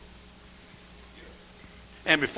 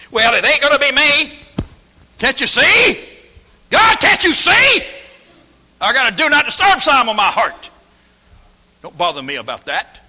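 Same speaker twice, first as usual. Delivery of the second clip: high, loud pleading shout; hammed-up defiant wail; mock refusal; 9 semitones higher, 11 dB louder than usual. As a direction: cry out to God leave me be. Voicing unwilling churchgoer.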